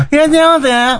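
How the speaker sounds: high, odd shop-clerk greeting; squeaky clerk welcome; bright loud shop welcome. Retold or imitated. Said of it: "変な高い声なの".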